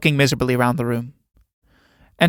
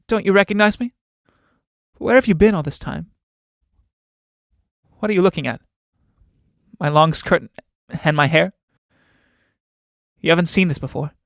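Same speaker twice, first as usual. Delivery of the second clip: put-on slightly higher, questioning young girl's part; a light, higher voice for a character fishing for notice; lightly voiced Judith, teasing; 4 semitones higher than usual. Judith showing Alan her grown-up look, lightly voiced and teasing.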